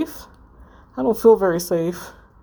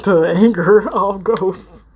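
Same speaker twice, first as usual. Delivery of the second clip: laughing through it; 4 dB louder, slower than usual. Real, not acted. Actor breaks up over flubbed line.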